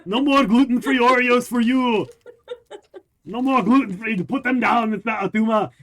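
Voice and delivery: loud, high, hammed-up Austrian accent; Arnold Schwarzenegger. Podcaster jokingly bans gluten-free Oreos, in loud mock Austrian accent. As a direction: bellow playful orders as an Austrian action hero. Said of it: "Do it in Arnold Schwarzenegger voice".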